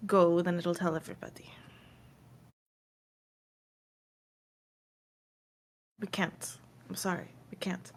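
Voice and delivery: very soft, half-whispered refusal; hushed, apologetic and halting; regretful Mayfair refusing. Acted. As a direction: refuse quietly, with heavy pauses. Game master voices Mayfair refusing.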